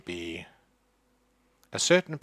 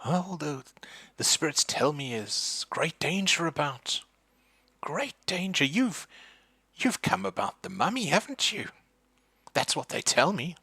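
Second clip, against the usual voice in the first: put-on much higher, ominous old woman's voice; mystic old lady; 7 semitones higher than usual. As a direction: warn them like a fussy psychic. Agatha the psychic speaks.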